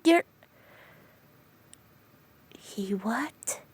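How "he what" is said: hushed, disbelieving he what; shocked whisper. Acted. Friend whispers disbelief at the news, hushed.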